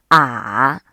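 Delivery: 啊 dipping low, then rising; third tone. Shown as a demonstration. Teacher demonstrating the third tone on 啊, a low dip then rise. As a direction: drop 啊 down first, then lift it. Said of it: "三声".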